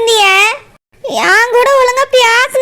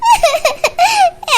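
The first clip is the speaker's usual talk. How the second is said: bitter laugh; 6 semitones higher than usual. Acted. Bitter laugh after betrayal.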